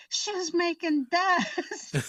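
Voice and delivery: high, drawn-out holler breaking into laughter; mock cry of alarm. Passenger calling out mid-take as the car speeds, hammed-up alarm. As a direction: call it out slowly and high, laughing. Retold passenger crying out while filming.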